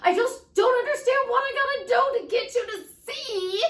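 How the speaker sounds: high, loud exasperated outburst; frustrated shout. Acted. Coach venting about clients into speech-to-text, frustrated. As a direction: yell it in sheer exasperation. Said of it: "and I'm frustrated".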